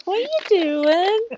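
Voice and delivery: high pitched startled question; high squeak. Retold or imitated. Friend's high quote.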